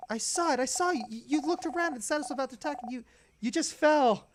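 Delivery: a hint of an alarmed companion; worried girl character. Bonnie questioning her friend about the fall, worried.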